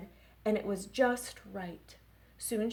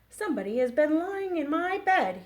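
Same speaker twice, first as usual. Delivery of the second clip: higher, louder cry; Papa Bear cries out; 6 semitones higher, 7 dB louder than usual. Acted. Librarian reading to kids, Papa Bear higher and louder. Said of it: "he cried".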